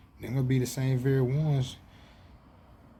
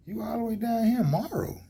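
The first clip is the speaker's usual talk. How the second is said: surprised exclaim; 11 semitones higher, 3 dB louder than usual. Retold or imitated. Surprised greeting of a displaced neighbor.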